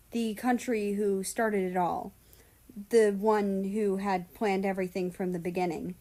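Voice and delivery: plain reading voice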